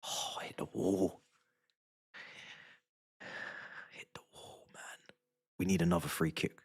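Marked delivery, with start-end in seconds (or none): 0.1-5.1 whispering. Real, not acted. Urging team under breath.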